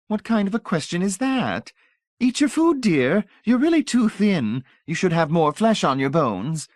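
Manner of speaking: put-on high, honeyed old lady voice; sugary old lady. A narrator's reading. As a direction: pour on the sweetness like a grande dame. Voices Mrs Pritchett.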